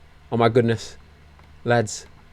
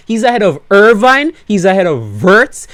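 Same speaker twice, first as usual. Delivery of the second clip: excited; 6 semitones higher, 6 dB louder than usual. Real, not acted. Listing rivals in excitement.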